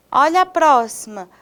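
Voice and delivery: normal talking voice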